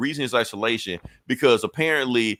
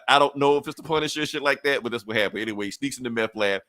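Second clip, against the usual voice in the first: ordinary delivery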